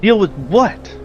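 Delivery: high-pitched, baffled demand; incredulous questioning. Acted. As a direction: press for answers with pitch jumping up. Character reacts to unexplained threat.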